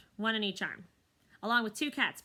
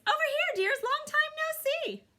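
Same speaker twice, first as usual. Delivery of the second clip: very high, slow sweet call; high, gushing granny cat; delighted old cat; 6 dB louder than usual. Acted. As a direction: coo to old friends. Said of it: "calls in a delighted voice".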